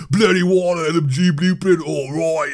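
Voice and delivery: badly faked Australian accent. Gamer reading out the shark blueprint, bad Australian accent. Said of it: "My accent is horrible".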